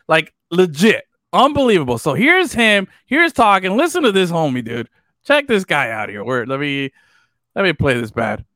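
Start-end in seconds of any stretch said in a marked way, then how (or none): none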